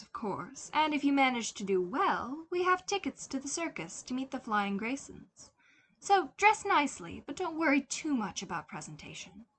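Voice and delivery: high, airy, cool society mother; breezy orders delivered sweetly; mother's voice. Acted. Mother telling her son about the city day, high and airy.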